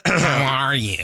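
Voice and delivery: put-on a light English take on a whiny American accent; mock whiny American accent. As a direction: sound like a Brit faking an American accent. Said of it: "I love an English person doing the American accent".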